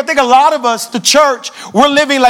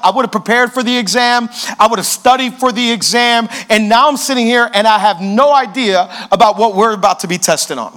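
usual speaking voice

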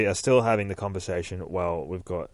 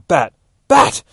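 loud cries, climbing higher; calling out; 8 semitones higher, 13 dB louder than usual. A narrator's reading. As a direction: shout urgently to someone hurt. Selina cries out to Batman.

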